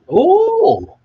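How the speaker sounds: sudden high loud yelp of surprise; startled shout. Real, not acted. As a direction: jump and cry out, startled.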